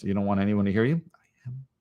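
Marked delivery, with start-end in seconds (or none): 1.1-1.7 barely audible whispered affirmation. Shown as a demonstration. Teacher demonstrates whispering it privately, hushed whisper. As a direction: breathe it out so nobody hears. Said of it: "You can whisper it".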